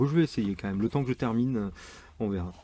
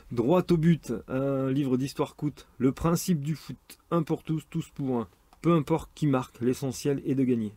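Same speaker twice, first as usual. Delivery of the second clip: higher, punchy promotional reading; blurb read; 4 semitones higher than usual. Shown as a demonstration. Presenter pitching a football book, punchy blurb reading. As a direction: proclaim it like a sports slogan. Reads back cover aloud.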